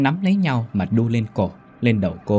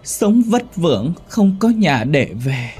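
put-on high, slow, louder accusing child's voice; a wounded little ghost turning bitter; ghost child's grudge; 8 semitones higher, 4 dB louder, much slower than usual. A narrator's reading. Child ghost accusing its mother of abandoning it.

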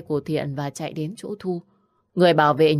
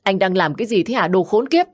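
raised, indignant outburst building to the insult; angry yell; 4 semitones higher, 4 dB louder than usual. Acted. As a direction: yell at him in outrage, louder on the curse. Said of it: "Hoài quát lớn". (quát giận dữ, cao dần)

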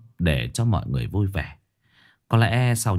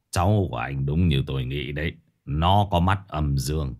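put-on stern old master; 5 semitones lower than usual. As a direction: command the workers calmly from low in the chest. Master tells grandfather about boy. (giọng thầy pháp trầm, chậm rãi)

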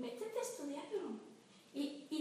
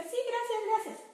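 much higher and louder, overly sweet thank-you; sugary thanks; 10 semitones higher, 7 dB louder than usual. Retold or imitated. New mother brushing off everyone's advice with sugary thanks. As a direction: gush the thanks to brush them off. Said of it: "yo siempre les decía".

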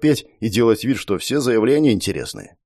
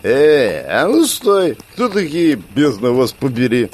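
put-on drunk woman's voice; 3 semitones higher, slower than usual. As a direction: bark it like a drunk guard. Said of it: "пьяный женский голос".

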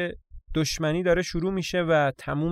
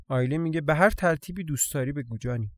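plain reading voice

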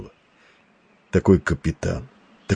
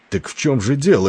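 impatient exclaim; 7 semitones higher than usual. A narrator's reading. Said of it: "нетерпеливо воскликнул Вейри".

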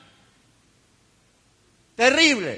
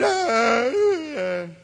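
mock whimper. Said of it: "se tiró a llorar y decir".

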